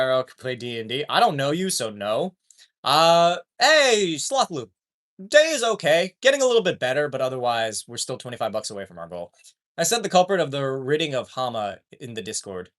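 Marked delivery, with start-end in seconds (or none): none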